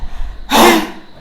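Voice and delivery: high unvoiced gasp of relief; relieved gasp. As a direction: let out a sharp breathy gasp. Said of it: "And I was like".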